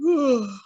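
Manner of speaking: put-on drowsy child yawn; sleepy yawn. A narrator's reading. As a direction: let out a tired yawn.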